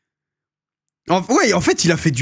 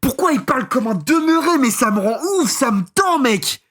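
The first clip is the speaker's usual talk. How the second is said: exasperated; 7 semitones higher than usual. Real, not acted. Exasperated reaction to video voice.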